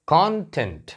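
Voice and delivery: Content as KON-tent, opening syllable punched; slow KON, falling tent in content; stress on first syllable. Shown as a demonstration. Teacher showing how it reads, content stressed on KON. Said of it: "यहाँ stress दिया तो ये पढ़ा जाएगा content".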